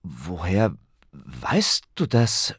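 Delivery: slow, halting, taken aback; stammering. A narrator's reading. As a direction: falter through the question, caught out. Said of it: "stammelte Ben".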